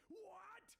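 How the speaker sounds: high, loud shocked shout; explosive villain squawk; Penguin. A narrator's reading. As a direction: shout it in outraged disbelief.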